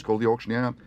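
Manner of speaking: ordinary delivery, nothing marked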